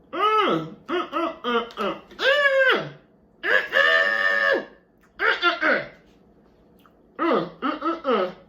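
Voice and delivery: delighted mmm sounds while tasting; savoring mmm. Real, not acted. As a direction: moan with pleasure over food. Savoring bite of lemon cake.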